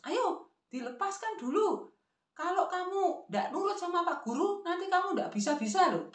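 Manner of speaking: high-pitched nagging grandmother. Acted. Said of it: "nada yang agak tinggi".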